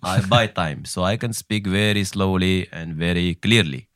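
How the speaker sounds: slow, unhurried put-on Qatari accent in English; a deliberately slow, clear Qatari caricature; mock Qatari accent. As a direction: lay out every word in the Qatari accent as if time costs nothing. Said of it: "il avait un côté very".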